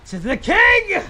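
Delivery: high, loud proclamation; proclaiming. Real, not acted. Streamer hailing a viewer as king, loud and high. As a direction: announce the king grandly.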